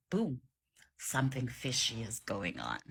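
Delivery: ordinary talk, nothing marked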